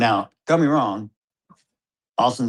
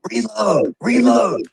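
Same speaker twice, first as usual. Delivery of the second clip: high, loud shouted command; yelling; 11 semitones higher, 5 dB louder, slower than usual. Retold or imitated. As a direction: yell it at the shooter urgently. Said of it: "Me and Hunter are yelling at him".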